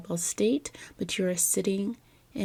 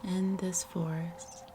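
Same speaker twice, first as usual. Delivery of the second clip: measured, soothing guide with long pauses; guided meditation; 4 dB quieter than usual. Acted. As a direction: gently lead the listener into relaxation, phrase by phrase.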